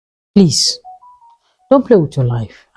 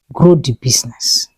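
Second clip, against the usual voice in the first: whispered advice; 6 semitones lower than usual. Real, not acted. Advising viewers on business.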